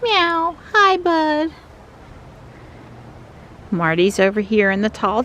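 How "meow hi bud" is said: high meow and greeting; pet voice. Real, not acted. Greeting the cat, high cooing meow.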